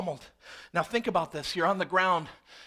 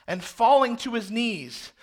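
ordinary talk, nothing marked

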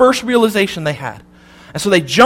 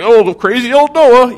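slow, higher, teasing jeer; mock Noah like a town gossip; mocking townsfolk; 4 semitones higher, 3 dB louder than usual. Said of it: "everyone's like".

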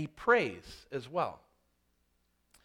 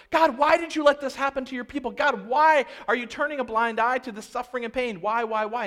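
raised, higher and louder pleading; anguished why prayer; 6 semitones higher, 6 dB louder than usual. Retold or imitated. Preacher mimics a desperate prayer, raised and pleading. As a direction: cry out to God demanding why. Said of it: "He doesn't start with".

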